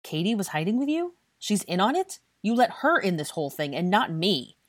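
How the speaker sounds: high, loud incredulous questions; indignant, rising outrage; furious demand. A narrator's reading. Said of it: "Blaise demanded furiously".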